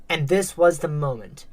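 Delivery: usual speaking voice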